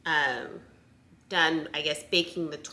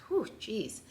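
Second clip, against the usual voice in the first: exhaling hard, winded; out of breath; 3 semitones higher, 9 dB quieter than usual. Real, not acted. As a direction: blow out a tired breath. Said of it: "I'm, like, out of breath".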